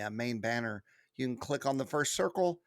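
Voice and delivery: ordinary delivery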